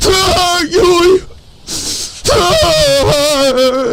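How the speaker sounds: high hammed-up scream of her name; fake-scream as if falling; mock falling scream. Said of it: "I'd be like".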